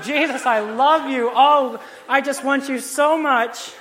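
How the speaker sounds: high, loud, slow, syrupy adoration; mock happy gush. Preacher mocks the happy song version, gushing sweetly. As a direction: ham up the sweet love song. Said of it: "this little happy song".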